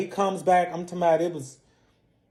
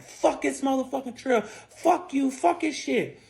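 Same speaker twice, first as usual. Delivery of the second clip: high-pitched angry cursing; acted-out fit of temper; retold tantrum; 8 semitones higher, slower than usual. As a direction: imitate him raging, pitched up. Imitates his rage while trashing room.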